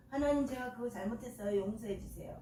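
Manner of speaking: softly acted apology to God; humble confession prayer. Sinner asking God to forgive, quiet confession. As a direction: drop into a soft plea for forgiveness.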